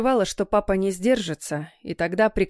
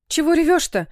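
higher, louder motherly burst; excited chattering; 8 semitones higher, 5 dB louder than usual. A narrator's reading. Mother to her crying daughter, excited chattering. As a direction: blurt it high and fussing. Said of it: "улыбнулась и сбивчиво протараторила".

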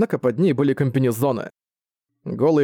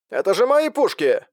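sci fi communicator; 7 semitones higher than usual. Acted. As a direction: voice over a comm link. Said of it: "сказал Крутыш через коммуникатор".

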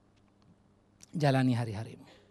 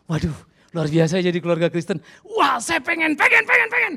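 loud, much higher, gushing cries of longing; envious onlooker; 10 semitones higher, 12 dB louder than usual. Retold or imitated. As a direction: squeal with envy like a neighbour who wants it too. Voicing envious outsiders.

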